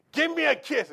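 loud, high, playful demand. Retold or imitated. Father chases his daughter for a kiss, loud and playful. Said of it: "run right up there".

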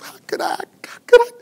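choked, half-whispered self-reproach; stage sob, words catching in the throat; acted weeping. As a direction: break down as you question yourself. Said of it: "he's weeping".

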